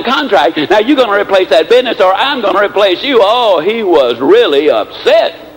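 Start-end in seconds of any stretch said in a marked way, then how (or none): none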